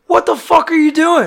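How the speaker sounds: high, loud incredulous demand; retold outraged shout; appalled yell. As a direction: confront him in loud disbelief. Confronts man digging up grave.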